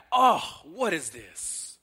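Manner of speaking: slow, loud-then-dropping groan of disgust; mock groan. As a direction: groan like an ungrateful child. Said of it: "they were just groaning".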